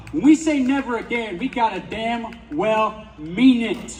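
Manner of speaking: building to a rallying shout; rousing shout. Real, not acted. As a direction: raise the voice like a protest speech.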